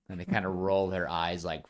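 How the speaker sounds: ordinary talk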